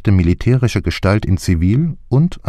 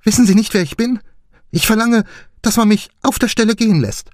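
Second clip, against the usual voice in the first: much higher, imperious and indignant; haughty Lady Horbury; faster than usual. A narrator's reading. Lady Horbury to the steward, haughty and furious. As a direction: demand it as a lady who is owed.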